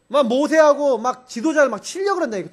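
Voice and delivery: ordinary delivery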